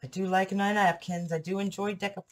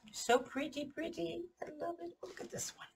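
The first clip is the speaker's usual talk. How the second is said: soft delighted coo; 11 semitones higher, 9 dB quieter than usual. Real, not acted. Cooing over napkins.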